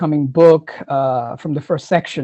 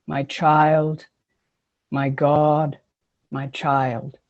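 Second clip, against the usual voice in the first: ordinary talk, nothing marked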